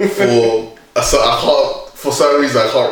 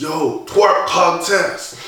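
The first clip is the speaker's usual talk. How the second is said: imitating a DJ, higher and drawn out; DJ announcement; 5 semitones higher, 4 dB quieter, much slower than usual. DJ to the Miami crowd, drawn-out announcing. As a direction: stretch it out like a hype announcer. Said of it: "like, announces".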